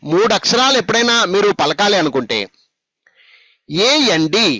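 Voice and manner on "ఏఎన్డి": ఏఎన్డి run together as one unit; joined letters. Shown as a demonstration. Teacher modelling for pupils how to read, ఏఎన్డి joined without breaks. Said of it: "చూడండి ఎలా పలకాలి".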